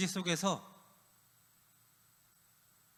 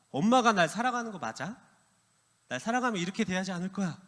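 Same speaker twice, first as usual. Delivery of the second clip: put-on accusing teen; 6 semitones higher, 5 dB louder than usual. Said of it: "엄마한테 이렇게 얘기했대요".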